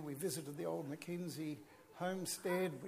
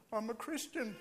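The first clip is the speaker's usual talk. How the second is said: emotional; 4 semitones higher than usual. Real, not acted. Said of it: "Pardon me for getting emotional".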